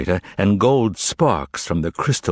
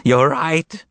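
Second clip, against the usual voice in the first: much higher, louder excited cry; shouted; 10 semitones higher, 4 dB louder, much slower than usual. Acted. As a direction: burst out in eager agreement. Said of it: "shouted Korovyov".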